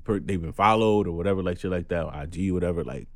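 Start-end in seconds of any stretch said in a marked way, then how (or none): none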